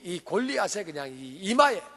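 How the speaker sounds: ordinary talk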